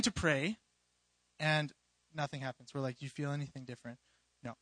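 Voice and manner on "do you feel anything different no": low, hushed, quick check-in; quiet deadpan retelling. Retold or imitated. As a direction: retell it softly, as a letdown. Retelling a prayer with no result.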